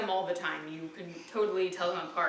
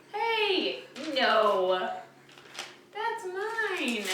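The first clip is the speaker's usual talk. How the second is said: playful high scold; 7 semitones higher, much slower than usual. Real, not acted. Mom to baby grabbing something.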